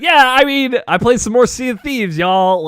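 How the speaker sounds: high, loud mock enthusiasm; imitating Tony. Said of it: "Tony's gonna be like".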